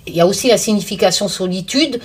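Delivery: normal talking voice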